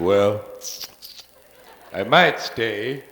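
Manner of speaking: slow, lazy low cat drawl; lazy mock-cat voice, slowed way down; smug cat. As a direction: drag it out like a smug tomcat. Cat voiced coming home smug.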